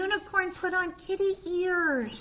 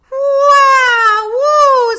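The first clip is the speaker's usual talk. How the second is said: loud, high, excited whoop; impressed; 6 semitones higher, 14 dB louder than usual. Acted. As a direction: cheer like you're really impressed.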